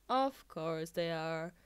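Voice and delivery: low, slow weary resignation; resigned. A narrator's reading. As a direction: give up with a tired drawl.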